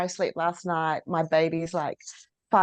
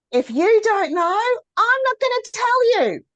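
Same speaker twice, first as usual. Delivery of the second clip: high, loud, sing-song mock reply; mimicking a sulky partner; 6 dB louder than usual. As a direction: toss it off like a sulky wife. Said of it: "one of the classic questions is".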